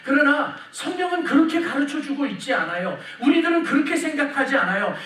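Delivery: normal talking voice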